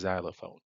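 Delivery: "xylophone" with its X pronounced as Z; initial Z sound. Shown as a demonstration. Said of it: "men l pwononse tankou yon Z". (di "xylophone" ak yon son Z nan kòmansman)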